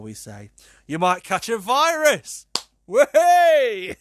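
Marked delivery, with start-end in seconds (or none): 0.9-2.5 loud mock warning. Host cracking a joke to listeners, loud and drawn out. Punchline about bonking an iPad. 2.9-4.0 loud, high whoop of glee; triumphant whey. Real, not acted. Host cheering his own iPad joke, a high whoop.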